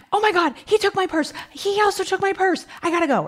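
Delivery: very high, rushed mock panic; frantic mom. Mom on phone with daughter about stolen purse, imitated as frantic. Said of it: "panicking, frantic".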